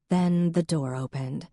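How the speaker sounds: ordinary delivery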